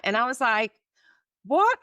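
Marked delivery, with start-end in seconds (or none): 1.4-1.8 high, loud disbelieving what; sudden shocked disbelief at the news. Retold or imitated. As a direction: blurt it out in shock. Shocked at missing nurse.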